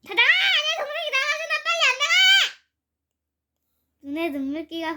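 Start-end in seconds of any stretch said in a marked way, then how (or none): 0.0-2.6 high, very loud angry yell; big brother screaming everyone out; furious shout. A narrator's reading. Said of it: "소리를 지릅니다".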